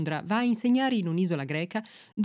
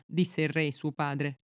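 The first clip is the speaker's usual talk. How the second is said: ordinary delivery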